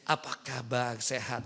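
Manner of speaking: low and softly, an ordinary greeting; normal soft voice. Shown as a demonstration. Showing women how to greet the ustadz in a soft normal voice. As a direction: ask gently how she is. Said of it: "Jadi suaranya yang biasa aja".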